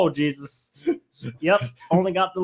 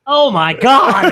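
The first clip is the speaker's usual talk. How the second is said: laughing; 4 semitones higher, 7 dB louder, slower than usual. Real, not acted. Reacting to gruesome ear photo.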